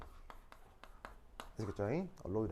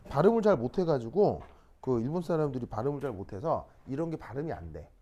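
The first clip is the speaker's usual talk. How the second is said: usual speaking voice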